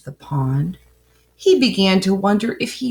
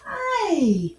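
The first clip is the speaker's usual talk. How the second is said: a light, higher pretend puppy voice; voicing the dog; 11 semitones higher than usual. Mom speaking for her poodle, light higher puppy voice. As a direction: squeak a tiny hi for the dog.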